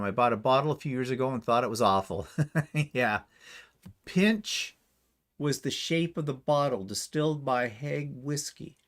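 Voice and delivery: ordinary talk, nothing marked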